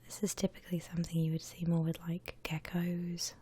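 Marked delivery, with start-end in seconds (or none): none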